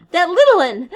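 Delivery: loud, high breathless cry; gasping alarm. Acted. Piggy noticing the missing boy, gasping in alarm. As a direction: gasp it out in panic. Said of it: "gasped Piggy".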